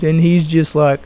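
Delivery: normal talking voice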